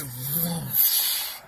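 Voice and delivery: low, drawn-out whoosh of flame; slow airy whoosh sound effect; mimicked flame whoosh. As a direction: blow out a long, soft flame noise. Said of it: "this flame was going like that".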